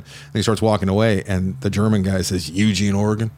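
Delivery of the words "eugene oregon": ordinary talk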